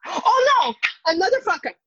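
gasp, then a high cry of dismay; hammed-up gasp and wail; mock shock. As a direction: act scandalized at another fumble. Mock shock at character death.

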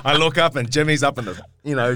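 usual speaking voice

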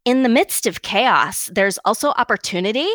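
statement read with a questioning lift, rising on "opportunity"; uptalk. Shown as a demonstration. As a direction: inflect up at the end of "opportunity" as if asking. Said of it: "read one of these in up talk".

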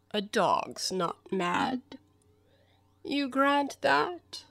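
very slow cat drawl, rising to the question; Cheshire Cat. Acted. The cat reasons with Alice about dogs, languid.

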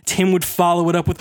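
normal talking voice

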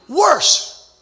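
"Worse" punched high and loud on its own; emphatic WORSE. Real, not acted. Preacher stresses how sick the other babies were, emphatic "Worse". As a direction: hit "Worse" hard as a one-word outburst.

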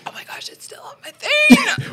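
high squeal of panic; squealing in alarm; panicked. Real, not acted. Panicked about sauce on gloves.

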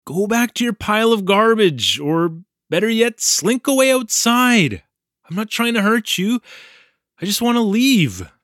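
high, pleading rant at the snake; frustrated outburst. A narrator's reading. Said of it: "Screaming at the snake".

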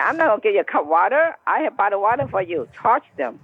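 ordinary talk

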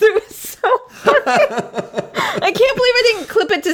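talking through helpless laughter; high-pitched words breaking into laughter; laughing. Real, not acted. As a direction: crack up mid-sentence. Recounting what made her laugh hard.

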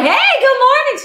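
high, slow sing-song good morning; fake-sweet wake-up greeting; overly cheery greeting. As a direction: chirp it way up high and bright, slowly. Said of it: "And I'm like".

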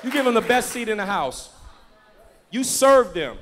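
loud pulpit exhortation; forceful, loud proclamation; full-force preaching. Real, not acted. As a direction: drive each command home to the congregation.

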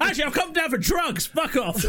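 high-pitched comic put-on voice; mock customer. Joining the bit as the customer, high mock voice.

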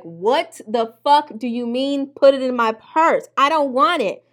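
a loud protest against putting the gun in her purse; indignant protest. Retold or imitated. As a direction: refuse it loudly, offended. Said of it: "And I'm like".

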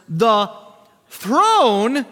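"throne" held long and loud after a pause; stress on "throne". Real, not acted. Preacher tells congregation to watch these words, "throne" drawn out loudly. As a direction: set up "the", pause, then boom out "throne".

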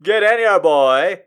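loud calling-out father; shouting dad. Acted. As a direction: call him in from across the house. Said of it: "he heard his father shout".

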